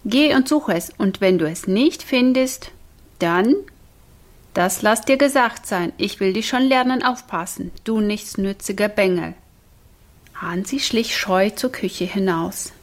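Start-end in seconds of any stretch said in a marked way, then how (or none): none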